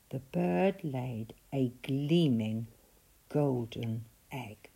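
plain reading voice

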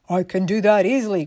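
loud tin soldier voice; confident cry. A narrator's reading. Tin soldier raising his gun, loud confident cry. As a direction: boast it out loudly. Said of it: "cried the tin soldier".

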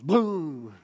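a loud booming "Boom!" as God speaking. Retold or imitated. Preacher booms God's voice to the congregation. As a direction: boom it out like thunder. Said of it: "he goes".

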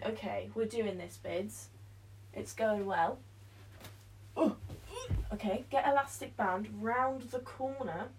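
ordinary talk, nothing marked

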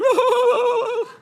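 high, loud "whoa" howl; mock weeping wail. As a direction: imitate a man bursting into tears. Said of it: "just say the name of Jesus and like".

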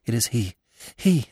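hushed, high and choking with terror; halting, frightened half-whisper; terrified whisper. A narrator's reading. Marina back from the meadow, whispering in terror. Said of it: "she whispered, her voice choking with terror".

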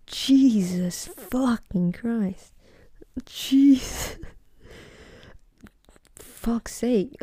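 slow, drawn-out disgust; disgusted disbelief. Real, not acted. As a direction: stretch every word in horror. Disgusted at giraffe tongue.

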